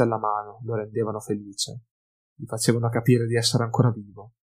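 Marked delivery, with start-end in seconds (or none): none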